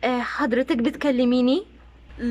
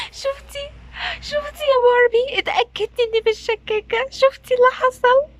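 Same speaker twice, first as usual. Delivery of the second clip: high, loud agitated protest; shrill complaint to her friend; upset outburst; 11 semitones higher, 3 dB louder than usual. Acted. Wife upset, proven right. (انفعال عالي ومتضايق)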